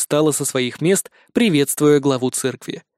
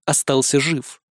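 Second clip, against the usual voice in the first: usual speaking voice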